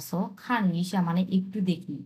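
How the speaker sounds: ordinary talk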